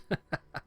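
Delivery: brief high laugh; short laugh. Real, not acted. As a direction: laugh at your own joke. Laughs at own joke.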